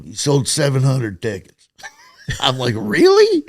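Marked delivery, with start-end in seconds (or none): 2.8-3.4 very high, loud, incredulous squeal; mock disbelief, voice leaping way up; comic retold astonishment; astonished. Stunned reply to ticket sales.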